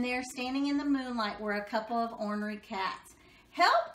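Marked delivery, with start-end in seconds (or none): 3.5-3.9 high, loud panicked yelp; cry for help. Acted. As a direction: yell it in a fright. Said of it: "cried the cats".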